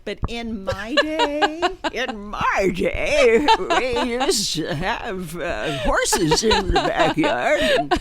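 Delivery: put-on slow, quavery old-timer drawl; old person voice. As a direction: talk like an old person reminiscing. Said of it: "I'm gonna talk like an old person right now".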